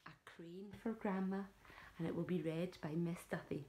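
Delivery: usual speaking voice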